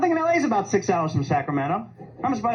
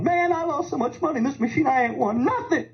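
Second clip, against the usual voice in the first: lunatic gambler; 8 semitones higher than usual. Retold or imitated. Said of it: "This lunatic comes up to me, starts complaining, going".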